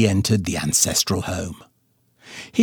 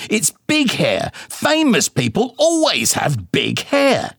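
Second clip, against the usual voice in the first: high-pitched, huffy mother voice; indignant mama; 10 semitones higher than usual. A narrator's reading. Mama bickering with daddy about his bald spot, high and huffy. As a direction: sniff it out, much higher, prim and offended.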